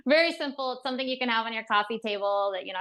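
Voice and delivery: ordinary delivery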